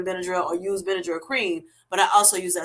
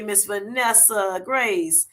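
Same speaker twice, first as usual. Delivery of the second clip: ordinary talk, nothing marked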